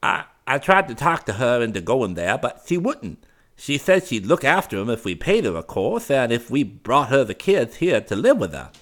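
high, loud, faltering nephew voice; hesitant fop. A narrator's reading. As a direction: stumble over it like a nervous dandy. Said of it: "replied the fop hesitantly".

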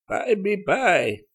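high, slow, drawn-out croak. A narrator's reading. Old woman answering a request for food, croaking. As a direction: croak it out slowly, pitched high. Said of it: "was the croaked response".

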